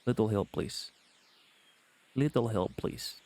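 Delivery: lightly voiced Russian babushka; Russian accent. Streamer attempting a feminine hunter, Russian accent. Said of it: "You sound like a little babushka".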